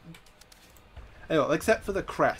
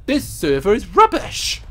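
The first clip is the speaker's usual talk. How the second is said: frustrated shout; 4 semitones higher, 7 dB louder, slower than usual. Real, not acted. Frustrated at server.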